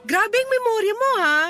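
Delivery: high-pitched, impressed exclamation; amazed. Acted. Friend amazed he still remembers, impressed and high-pitched.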